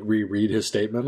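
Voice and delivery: usual speaking voice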